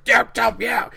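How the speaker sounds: high, loud mocking yeahs; defiant yeah. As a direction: keep snapping back. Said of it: "she's still like".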